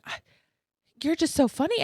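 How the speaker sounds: high, amazed "you're just so funny"; surprised praise. Retold or imitated. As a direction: gush it like you can't believe it. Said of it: "he kept going".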